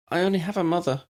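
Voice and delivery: put-on high childlike voice; a small girl answering plainly; young girl Lydia. A narrator's reading.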